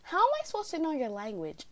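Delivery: high-pitched mock disbelief; indignant protest. Student answering teacher's accusation, indignant protest. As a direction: protest your innocence in a high voice.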